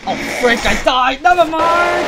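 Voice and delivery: loud, high yelp of surprise; startled shout. Real, not acted. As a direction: yell it out suddenly. Dies suddenly in the game.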